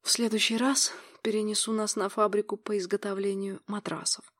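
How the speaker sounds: said on a breath, lightly voiced lover; breathy. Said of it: "произнёс придыханием".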